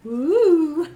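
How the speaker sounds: cheering whoop with a laugh; excited whoop. Real, not acted. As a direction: let out a happy cheer. Cheering the Halloween countdown.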